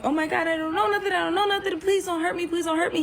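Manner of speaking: high, rushed, frantic begging; panicked pleading. Retold or imitated. Pleading with the man holding the door, high-pitched panic. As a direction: re-enact begging in a panic, words tumbling out high. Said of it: "so I'm panicking now".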